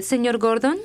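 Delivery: ordinary talk, nothing marked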